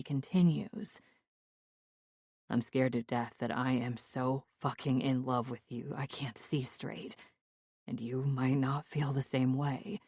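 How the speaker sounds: lightly voiced Quinn, earnest and tender; tender male lover. Quinn confessing love.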